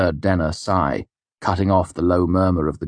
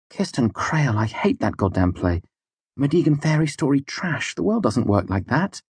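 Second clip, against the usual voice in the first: higher, spitting contempt; venomous; 9 semitones higher than usual. A narrator's reading. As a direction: sneer at the silly play. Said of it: "her tone more venomous".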